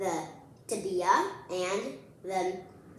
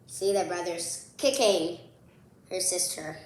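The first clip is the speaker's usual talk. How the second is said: usual speaking voice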